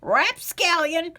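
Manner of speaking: put-on old man snapping at the rapscallion; snapped. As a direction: scold the cheeky youngster sharply. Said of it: "snapped the old man".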